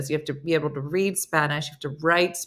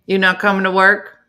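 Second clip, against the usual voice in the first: loud call across the room; 7 dB louder than usual. Real, not acted. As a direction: call over to someone lying in the corner. Calling to someone in the corner.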